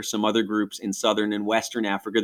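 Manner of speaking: normal talking voice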